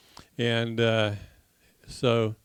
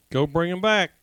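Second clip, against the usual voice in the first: acted dog command; 5 semitones higher, 5 dB louder than usual. Cajun commands his dog.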